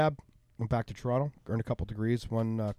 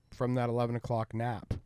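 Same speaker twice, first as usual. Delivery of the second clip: ordinary talk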